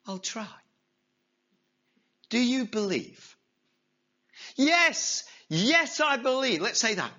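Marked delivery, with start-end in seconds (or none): 0.1-3.4 calm voice from above. Acted. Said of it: "the voice from above said". 4.5-6.6 high, loud eager yes; eager affirmation. Acted. Man over the cliff eagerly insists he believes.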